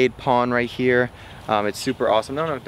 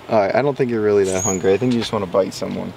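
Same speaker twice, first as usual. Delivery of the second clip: ordinary delivery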